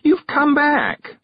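slow, delighted and tender. Acted. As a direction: greet a returned friend with joy. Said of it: "her face one huge smile".

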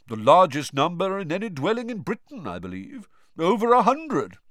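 put-on high, airy, prim old ghost; a fussy gentleman ghost, surprised; genteel ghost. Ghost Nick explains.

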